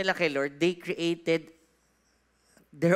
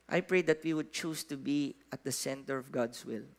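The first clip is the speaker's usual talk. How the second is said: prayer; 8 semitones lower, 5 dB quieter than usual. Acted. Pastor prays over the congregation.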